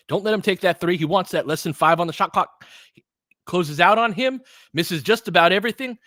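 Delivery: announcer urging the defense late in the shot clock; tense call. Real, not acted. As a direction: press the words as the clock winds down.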